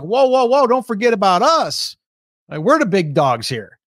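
lightly acted big company butting in; Alibaba. Alibaba reminding rivals it is there, lightly acted protest. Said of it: "Alibaba's like".

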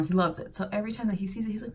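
ordinary talk

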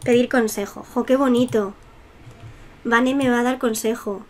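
ordinary delivery

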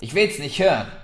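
firm. A narrator's reading. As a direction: shut him down firmly. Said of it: "sagte Murdra bestimmt".

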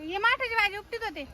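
high-pitched explaining voice; innocent excuse. Acted. Covering up to the man calling her. (चढ्या सुरात सारवासारव)

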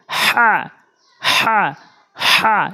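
"হা" with a strong throat friction; careful Arabic pronunciation. Shown as a demonstration. Teacher corrects a common error, "হা" with throat friction. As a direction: push "হা" out tight from the throat. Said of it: "ছোট হা এনে উচ্চারণ করে, অশুদ্ধ তেলাওয়াত হয়".

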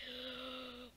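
quick frightened gasp in; sharp gasp. Retold or imitated. Scared kid gasping at a sudden worry. Scared kids imagining the teacher.

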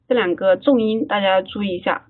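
usual speaking voice

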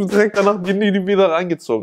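usual speaking voice

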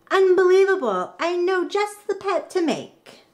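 high, loud burst of delight; thrilled girl who can't believe it; squealing with delight; excited. Acted. Katie thrilled at the machine.

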